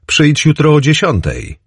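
called out high across the café; calling after him. A narrator's reading. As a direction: call it after someone walking away. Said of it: "krzyknęła za nim".

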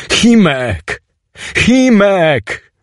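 high cry, rising on the repeat; frightened calling. Acted. Teoś calls to Chimek after the blast, high anxious cry. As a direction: call out for a friend who won't answer.

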